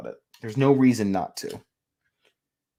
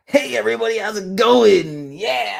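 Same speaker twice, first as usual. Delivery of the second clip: Biden impression; 7 semitones higher, 5 dB louder than usual. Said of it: "pop a pose".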